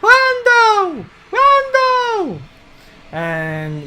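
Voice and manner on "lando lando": high, loud, terrified screaming of his name; scared shriek. Acted. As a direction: shriek for him in panic. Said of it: "You hear a shriek".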